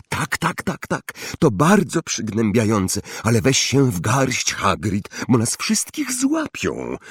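ordinary delivery

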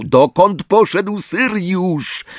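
high, drawn-out demand; restrained roar. A narrator's reading. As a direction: demand an answer slowly, pitched well up. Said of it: "ryknął Harry". (stłumiony ryk, wysoko i powoli)

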